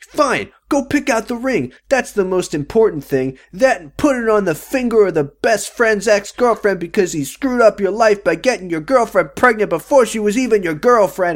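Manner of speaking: mocking Leo. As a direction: rant it like a bitter dad. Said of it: "he's like".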